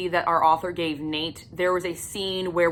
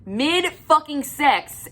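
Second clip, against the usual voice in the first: usual speaking voice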